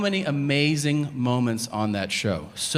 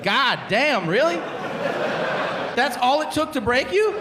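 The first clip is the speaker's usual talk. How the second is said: mock disbelief; 11 semitones higher than usual. Disbelief at quick confession.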